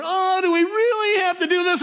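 put-on high, whining kids' complaint; high nasal-free whine of bored children; whiny kids. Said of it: "and they're".

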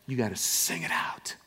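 soft unvoiced urging; whispered. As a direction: whisper the call to sing. Hushed sermon line.

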